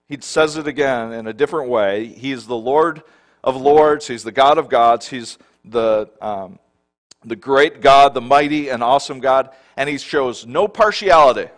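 loud, building pulpit proclamation; full-force preaching. Acted. Pastor exalting God's titles to his congregation, loud and building. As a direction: hammer each title of God home.

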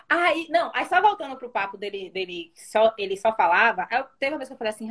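normal talking voice

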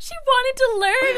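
very high, loud put-on kid voice; hammed-up high-pitched protest; mock-earnest excuse. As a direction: squeal the excuse in imitation. Repeats the kid's absurd excuse.

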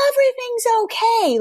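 very high, sing-song everything's okay; mock sugary reassurance. Parent fake-soothing child, very high sing-song. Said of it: "It is not".

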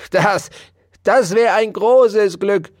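a hamster yelling back at the mouse; shouting hamster. Acted. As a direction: blurt it out gleefully. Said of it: "schrie Ambrosius Dauerspeck".

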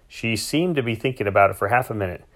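plain reading voice

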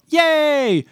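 high-pitched cheer; mock kids' cheer. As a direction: cheer like a kid promised cake. Voicing cheering kids at party.

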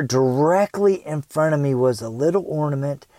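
ordinary talk

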